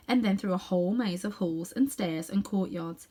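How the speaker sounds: plain reading voice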